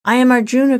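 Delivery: restrained mournful cry. A narrator's reading. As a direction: cry out your name plaintively. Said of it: "cried a third voice".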